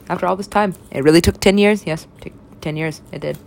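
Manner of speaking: ordinary delivery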